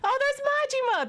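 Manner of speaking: high, delighted gasp of a name; excited fan squeal. Retold or imitated. Localization fans spotting Majima, squealing high.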